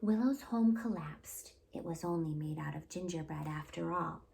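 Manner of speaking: usual speaking voice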